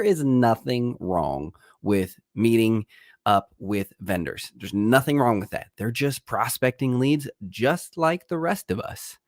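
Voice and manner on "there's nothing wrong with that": ordinary talk, nothing marked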